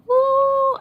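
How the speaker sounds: high loud whoop; squealing cheer; excited woo. Real, not acted. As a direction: whoop for joy.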